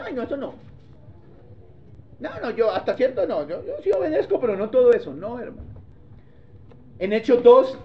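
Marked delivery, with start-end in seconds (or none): 0.0-5.1 whiny, laughing dodge of God's instruction; hammed-up reluctant believer; mock excuse. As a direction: imitate someone wriggling out. Mimicking half-hearted obedience.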